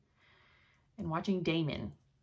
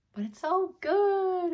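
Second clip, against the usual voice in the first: much higher, louder, slow and drawn out; gushing; 13 semitones higher, 6 dB louder, slower than usual. Real, not acted. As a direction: swoon over the show, stretching it. Gushing about The Last Kingdom.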